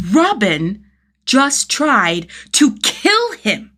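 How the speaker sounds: repeating the realisation, now loudly outraged; outraged disbelief. A narrator's reading. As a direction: let the disbelief explode. Shock turning to outrage.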